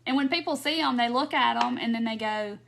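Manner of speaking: ordinary talk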